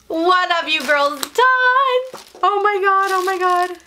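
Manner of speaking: real disbelief at the gift; overwhelmed delight. Real, not acted.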